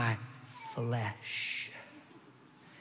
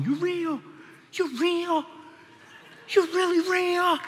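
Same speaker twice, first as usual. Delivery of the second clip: much higher, astonished voice; replaying the moment Jesus felt real, pitched way up; disbelieving wonder; 13 semitones higher, slower than usual. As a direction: gasp it out in amazed disbelief. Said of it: "I was going".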